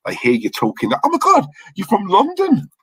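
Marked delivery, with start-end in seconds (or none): 1.0-2.7 high-pitched impression of an amazed girl at the bar; excited girl. Girl at the bar hears a London lad talking, high excited squeal. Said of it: "There's a group of girls at the bar".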